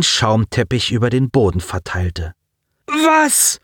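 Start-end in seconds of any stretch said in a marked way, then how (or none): 2.9-3.6 very high, horrified cry; shocked shout. Acted. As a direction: scream it in disbelief. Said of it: "schrie Simon".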